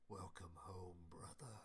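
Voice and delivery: low, breathy ghostly whisper; Guardians of the Afterlife voice. Acted. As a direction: whisper it from beyond the grave. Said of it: "An extremely Guardians of the Afterlife voice".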